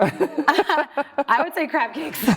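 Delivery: giggling answer; laughing. Real, not acted. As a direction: say it slowly through laughter. Laughs while giving her answer.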